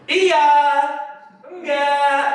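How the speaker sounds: sharp, loud and high; ngegas. Shown as a demonstration. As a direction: snap back impatiently at the customer. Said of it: "kata anak zaman sekarang ngegas gitu". (jawab ngegas, keras dan tinggi)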